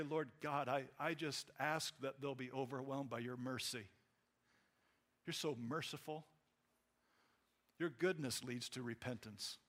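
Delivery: soft, humble plea to God; softly spoken prayer of mercy; gentle prayer. Acted. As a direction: pray tenderly for mercy, quietly. Acting out a gentle pleading prayer.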